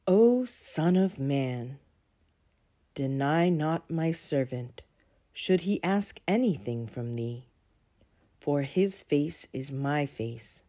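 reading scripture. Acted. Reads a sacred writing.

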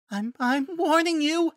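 very high, frightened little voice; scared, squeaky attempt at a threat; timid warning. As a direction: stammer a feeble warning, very high. Timid druid warns attacking wolves.

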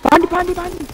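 high, loud urgent warning; urgent shout. Real, not acted. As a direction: yell it out to warn him. Warning teammate of zombie behind.

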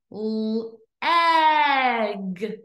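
"L-" held alone, then stretched "egg"; sounded out. Shown as a demonstration. Teacher breaking a word up for pupils, sounded out. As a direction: split off the L, then draw out "egg". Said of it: "What sound do you hear".

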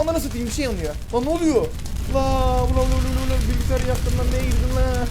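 loud, high panicked shouting at the screen; frantic loud yells of panic; panicked yelling. Real, not acted. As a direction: yell in alarm as the computer burns. Computer catches fire, panic.

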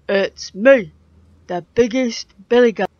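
big goat. A narrator's reading. Biggest goat stands up to the troll, loud and bold.

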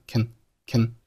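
"can" reduced to a soft "kn"; short, unstressed "can"; weak "can". Shown as a demonstration. Teacher demonstrating the weak form of "can". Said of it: "情态动词can是弱读".

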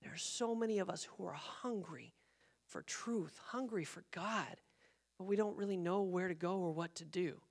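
very soft, heartfelt plea; hushed earnestness. Retold or imitated. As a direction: share a longing softly, letting it linger. Retells young woman's earnest confession.

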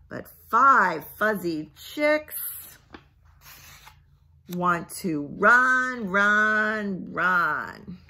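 put-on lively read-aloud to a child; storybook reading. Parent reading a farm book to a child, lively read-aloud. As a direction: read it brightly for a toddler.

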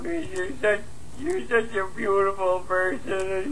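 slurring, weepy, overly sentimental call; drunk late-night voicemail. Acted. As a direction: pour your heart out, tipsy and teary.

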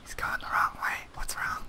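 unvoiced whisper. Real, not acted. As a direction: whisper it secretly. Whispered aside.

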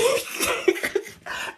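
airy high-pitched laugh; a breathless giggle; high breathy laugh. Real, not acted. Laughing at Cancer's ignorance, airy giggle.

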